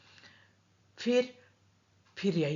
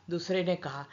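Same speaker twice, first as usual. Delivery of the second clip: ordinary delivery, nothing marked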